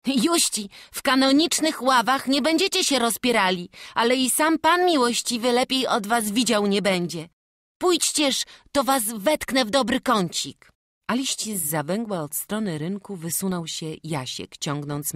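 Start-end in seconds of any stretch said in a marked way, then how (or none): 0.1-10.7 lively young helper, voiced higher; bright eager lad speaking to the family; character voice. Young church helper inviting the family in, eager.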